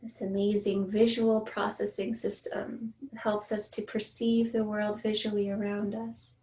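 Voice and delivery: measured meditation guide, long pauses between phrases; guided meditation. Acted. Meditation teacher inviting listeners to notice their senses, guided meditation voice. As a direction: ease listeners gently through each sense, unhurried.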